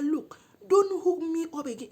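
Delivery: high, loud retold scolding; angry warning. Woman warning friend who hooked her up, angry. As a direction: warn her off loudly.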